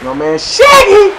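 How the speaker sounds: high, slow hype call; excited drawn-out cheer. Real, not acted. Cheering teammate Shaggy's big play, excited. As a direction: stretch it out in delight.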